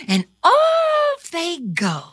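usual speaking voice

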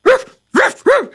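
loud high barks; dog barking. A narrator's reading. Dog barks at the intruders in the parlor, loud barking. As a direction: bark like an eager dog.

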